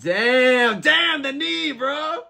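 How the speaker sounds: soaring hyped cry; excited. Real, not acted. Streamer seeing a big knee land, excited cry. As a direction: yell about the knee, way up high. Live fight reaction.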